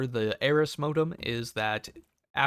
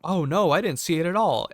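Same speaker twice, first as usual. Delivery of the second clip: a higher, louder feigned "oh no"; loud, high fake innocence; 6 semitones higher, 6 dB louder than usual. To the woman with the machete, a loud, high feigned oh no. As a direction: play dumb, loud and eager. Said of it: "I have to go".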